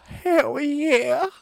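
high, very slow and loud; drawn-out hell yeah. Real, not acted. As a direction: stretch it out with glee. Eager reply.

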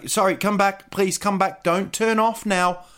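high, pleading call to come back; mock pleading. Begs listeners not to switch off.

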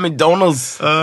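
slow, high disbelieving echo; incredulous echo. Retold or imitated. Echoing the beggar's excuse, slow and high in disbelief. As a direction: drag it out in disbelief.

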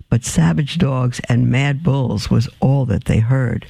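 ordinary delivery

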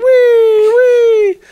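squealed high and loud; a childlike joyful squeal; gleeful wee. Pretend. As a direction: sing out wee like a kid on a slide. Imagining flying in skydive.

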